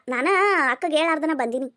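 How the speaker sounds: usual speaking voice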